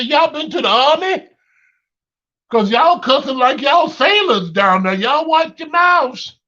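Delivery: loud, high scolding holler; scolding yell. Retold or imitated. Dad scolding his sons for swearing, loud and high. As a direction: call down and scold them. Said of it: "But say".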